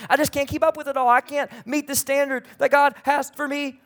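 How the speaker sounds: overwhelmed complaint. Acted. Acting overwhelmed normal person.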